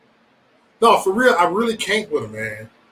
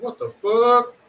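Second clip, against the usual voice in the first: baffled disbelief; 6 semitones higher, much slower than usual. Retold or imitated. Acting out his baffled reaction.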